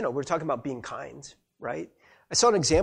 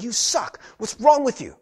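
high, raised-voice scolding; acted parental yell, loud and exasperated; yelling at child; 7 semitones higher, 6 dB louder than usual. Parent yelling at a child, angry scolding. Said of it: "You yell at a child".

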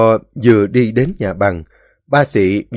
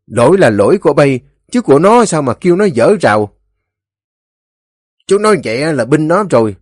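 raised, quick-paced mild quarrel voice; heated argument; 6 semitones higher, 5 dB louder, faster than usual. A narrator's reading. Villagers argue over whose fault the fence is, heated. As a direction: argue back insistently, pitched up. Acted argument before the elders.

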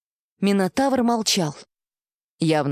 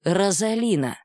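plain reading voice

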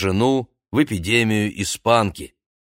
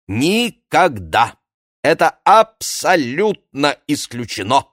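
louder, Никогда drawn out slow; emphatic insistence; 5 semitones higher, 4 dB louder, slower than usual. Acted. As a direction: hammer home Никогда with a slow rise. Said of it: "Пьер Мари повторяет".

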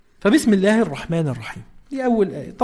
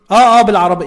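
louder, casual phone answer; 2 semitones higher, 8 dB louder, faster than usual. Shown as a demonstration. Teacher acting out a phone call, loud. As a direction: act out picking up a call. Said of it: "يعني أنا ماسك تليفون".

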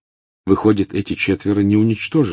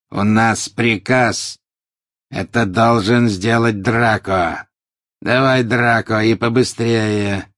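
slow, louder, hard-edged orders; harsh commander; 4 semitones higher, 4 dB louder, slower than usual. A narrator's reading. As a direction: bark the command down at Draco. Harsh Death Eater orders Draco. (жёсткий приказ, громче и медленнее)

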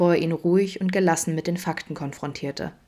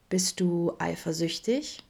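slow, softer, playful tease; amused teasing; 5 dB quieter, slower than usual. A narrator's reading. Vincent teases Adam about jealousy, amused. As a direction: tease him gently and slowly. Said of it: "Vincent legte amüsiert den Kopf schief".

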